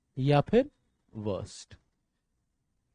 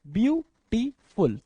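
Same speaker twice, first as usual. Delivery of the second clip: Beautiful in three slow, detached beats; broken into syllables; 3 semitones higher, much slower than usual. Shown as a demonstration. Teacher repeating the word in three goes, beautiful syllable by syllable. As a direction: pause after each syllable of beautiful. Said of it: "तीन बार में बोला".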